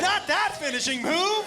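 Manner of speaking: high, drawn-out objection; slow, high-pitched dismay; horrified protest. Retold or imitated. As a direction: cry out in mock horror. Horrified reaction to the move.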